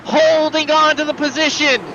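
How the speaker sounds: high, excited live race call; motorsport commentator. Real, not acted. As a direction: call the race lap by lap, fired up.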